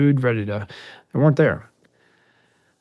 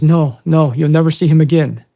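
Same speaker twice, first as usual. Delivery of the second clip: retold alarm, higher and louder; raised, agitated voice of a scared kid; nervous refusal; 5 semitones higher, 8 dB louder than usual. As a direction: act out the frightened kid's refusal. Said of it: "getting nervous, like I'm about to cry".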